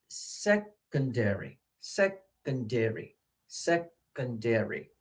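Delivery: slow, soft "secondary" with "sec" set apart; first-syllable stress. Shown as a demonstration. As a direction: break "secondary" after a stressed "sec", then slowly "-ondary". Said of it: "stress is on the first one".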